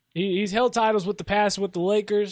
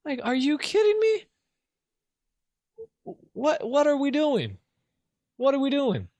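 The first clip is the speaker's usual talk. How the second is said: higher, disbelieving outburst at the team; exasperated rant; 6 semitones higher than usual. Real, not acted. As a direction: throw up your hands, are you kidding me. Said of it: "that's my little rant".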